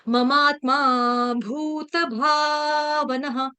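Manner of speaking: very slow, with each भ in भावनः clearly breathy; careful recitation. Shown as a demonstration. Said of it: "आपको बार बार जोर देना". (धीमा श्लोक पाठ, भ पर ज़ोर)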